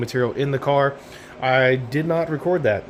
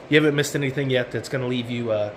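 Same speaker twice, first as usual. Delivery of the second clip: ordinary talk, nothing marked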